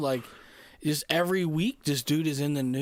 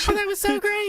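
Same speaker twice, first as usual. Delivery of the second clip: high, loud squeaky woman; 6 dB louder than usual. Acted. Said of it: "she's got a really squeaky voice".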